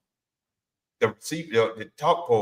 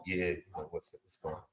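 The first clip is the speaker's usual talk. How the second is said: very soft, deep phone murmur; talking low; 9 semitones lower, 15 dB quieter than usual. Retold or imitated. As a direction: play it cool, low and hushed. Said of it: "Why you talking so low?".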